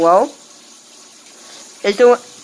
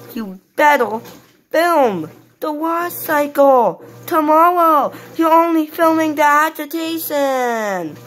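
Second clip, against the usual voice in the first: higher, slow, insistent toy voice; bossy baby Teddy; 6 semitones higher, slower than usual. Acted. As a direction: order him about like a small child. Teddy demands the full film.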